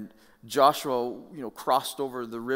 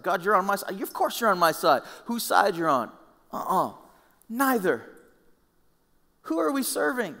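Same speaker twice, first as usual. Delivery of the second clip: heated exchange; 9 semitones higher, 6 dB louder than usual. Acted. Acting the exchange with God.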